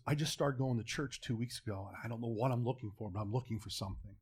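hushed, low, imitated confiding; furtive confession. Manager confiding about searching at church, furtive confession. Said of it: "making sure no one's looking".

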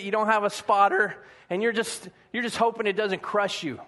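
ordinary delivery

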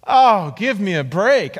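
mildly exasperated, stretch out the "oh"; mock exasperation. Young traveler reacting to a missionary claim, mock exasperation. Scoffing retort in retold exchange.

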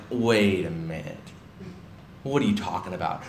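low, soft, slowed-down disbelief; stunned disbelief. Retold or imitated. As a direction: let it sink in, then question him quietly. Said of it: "He heard this and was like".

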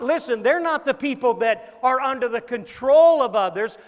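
loud, high, driving sermon; forceful preaching. Real, not acted. Preacher warning against controlling others' lives, forceful preaching. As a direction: hammer the warning home to the congregation.

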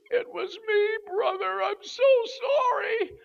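put-on high, crying old lady's voice; high tearful old lady confessing to the preacher; tearful apology. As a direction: sob out the apology as her.

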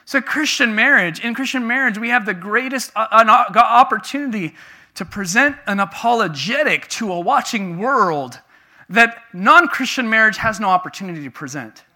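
loud, high, emphatic sermon delivery; preaching. Real, not acted. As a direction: preach it out with conviction. Preaches at full force.

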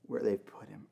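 breathy whisper; Mary at the tomb, whispering through tears. Acted. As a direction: let her voice fall to a whisper. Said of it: "she said".

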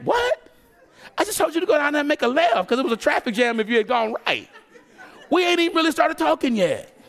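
high, loud incredulous God, laughing; exasperated disbelief. Retold or imitated. God reacting to the misread sign, incredulous and laughing. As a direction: throw up your hands in disbelief.

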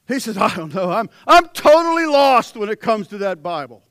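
punchy, shouted pulpit delivery; full-force preaching. Real, not acted. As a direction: preach it out to the whole church.

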